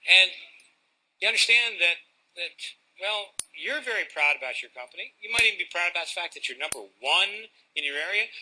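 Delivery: normal talking voice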